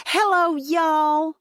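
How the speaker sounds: put-on high, drawn-out Texas accent greeting; a thick Texas accent, sung out slowly. A narrator's reading. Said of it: "in a Texas accent so concentrated".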